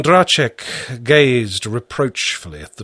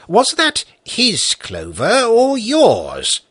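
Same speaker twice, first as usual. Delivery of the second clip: higher, slow, probing question; sharp judge; 11 semitones higher, slower than usual. A narrator's reading. Judge cuts in to question the peasant, slow and probing. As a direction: pin down whose clover it was.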